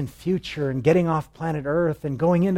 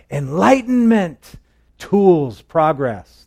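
high, loud, drawn out; mock grand; 3 semitones higher, 7 dB louder, much slower than usual. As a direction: proclaim it like a mock revelation. Mocking caveman's discovery.

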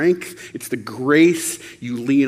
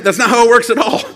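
higher and louder, fast and insistent; emphatic outburst; 8 semitones higher, 8 dB louder, faster than usual. Real, not acted. Preacher to congregation, an insistent higher louder protest. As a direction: push it out like a protest.

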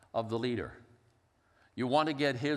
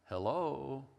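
"Hello" sung out in three gliding tones; imitated father-in-law's three-tone phone "Hello". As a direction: glide "Hello" through three notes. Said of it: "fell into saying it that way".

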